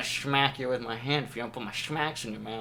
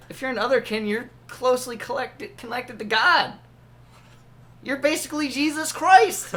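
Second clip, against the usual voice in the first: high, loud preachy proclamation; hammed-up revelation; mock preacher shout; 10 semitones higher, 4 dB louder than usual.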